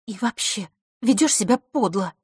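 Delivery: plain reading voice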